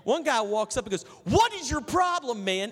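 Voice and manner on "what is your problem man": loud, high, angry shout at a stranger; angry yell. Retold or imitated. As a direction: yell it at him like he insulted you. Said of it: "and yells at me".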